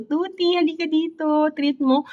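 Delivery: high, sweet coaxing call to the cat; lifted pet-calling voice. Retold or imitated. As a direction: sing out to lure the cat with a treat. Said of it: "sabi ko".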